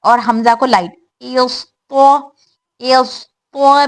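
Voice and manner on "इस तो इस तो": इस, तो drawn out slowly; slow drill. Shown as a demonstration. Teacher drilling syllables slowly for students. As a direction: stretch each syllable for students. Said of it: "slow syllable drill".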